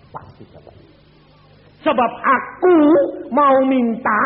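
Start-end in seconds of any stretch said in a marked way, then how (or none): 1.8-4.2 loud preaching burst. Acted.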